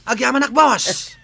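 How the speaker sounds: excited thanks. Acted. Henchman thanked for payment.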